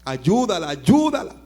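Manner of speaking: loud, rising cry for help; preacher's urgent prayer shout; urgent plea. Retold or imitated. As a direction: beg loudly for her rescue.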